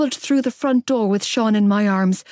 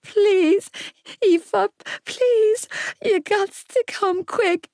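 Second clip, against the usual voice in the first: put-on high, urgent child's plea; crying child; 12 semitones higher, slower than usual. A narrator's reading. Frightened little boy begging her to hurry, crying. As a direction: beg in a small, tearful boy's voice. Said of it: "he cried down the line".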